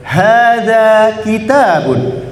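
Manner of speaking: drawn-out, deliberate Arabic demo; slow model phrase. Shown as a demonstration. Teacher leading class repetition, slow and clear. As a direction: stretch each word for the class to copy. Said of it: "Bisa diulangi bareng-bareng".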